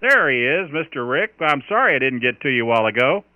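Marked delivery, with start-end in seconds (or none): none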